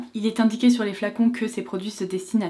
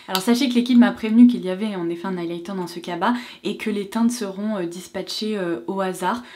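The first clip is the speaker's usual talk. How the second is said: ordinary talk, nothing marked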